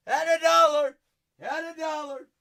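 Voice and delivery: high, loud repeat; excited shout. Real, not acted. Host repeating the dollar price, loud high shout. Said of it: "Had a dollar repeated".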